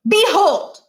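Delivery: loud, high triumphant reveal; dramatic cry. Acted. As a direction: fling it out at the big reveal. Niles's big reveal.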